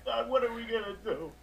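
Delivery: high-pitched wail breaking into sobs; tearful despair. Acted. Coach laments every injury to his manager, tearful despair. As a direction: wail it, cracking into a tearful whine.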